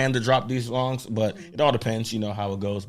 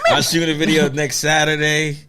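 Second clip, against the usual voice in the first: laughing; 5 semitones higher, 7 dB louder than usual. Real, not acted. Guest laughing while answering.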